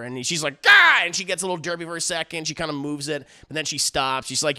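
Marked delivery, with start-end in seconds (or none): 0.6-1.1 high, loud yelp, hammed-up; mock startled cry. Woman hit by the Voice, mock startled cry. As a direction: act out being jolted against your will. Mother jolted by the Voice.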